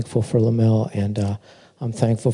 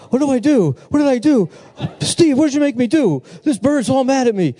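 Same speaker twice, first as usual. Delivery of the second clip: much higher, flustered pleading voice; mock panicked friend; 13 semitones higher than usual. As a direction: play a confused friend caught off guard. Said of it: "and Mel was like".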